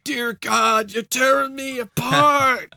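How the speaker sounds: high, slow, hammed-up anguished cry; imitating James Brolin. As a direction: overact a tormented haunted husband. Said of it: "he's like".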